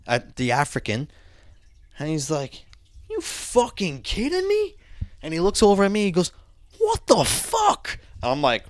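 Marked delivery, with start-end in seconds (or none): 3.1-4.7 very high, slow, hushed disbelief; retold incredulous outrage. Guard looking up at the prisoner, high mock-incredulous. 6.8-8.0 drawn-out, very high incredulous question; retold appalled disbelief. Guard turning to the kid, slow high mock disbelief.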